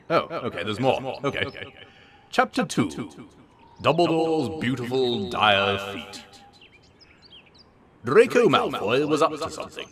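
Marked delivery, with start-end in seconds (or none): none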